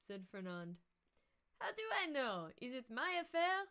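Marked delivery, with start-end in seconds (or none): 1.6-3.6 high, loud nasal protest; nasal, indignant questioning; nasal character voice. Acted. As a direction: shrug it off in a nasal voice. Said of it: "I think I lost the nasal".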